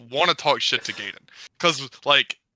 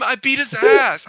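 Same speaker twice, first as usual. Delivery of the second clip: higher, louder and slow boast; bragging about the win; gloating brag; 11 semitones higher, 6 dB louder than usual. Retold or imitated. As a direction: rub it in. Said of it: "'cause he's like".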